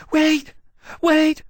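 high shrill cry from far off; shrill dragon calling out; Persitia crying out. Acted. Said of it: "Persitia cried shrilly from a distance".

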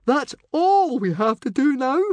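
very high, loud incredulous squeal. A narrator's reading. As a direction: squeal the plan back in panic. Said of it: "squealed Fishlegs".

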